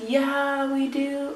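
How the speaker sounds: high, bright-pitched clerk answering the phone; perky store clerk. Retold or imitated. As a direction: play the cheery clerk on the line.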